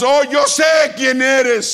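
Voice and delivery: high, slow, shouted recognition; fervent exclamation. Retold or imitated. Woman telling the Lord she knows him, exclaiming. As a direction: cry it out high and drawn-out. Woman's reply acted in preaching.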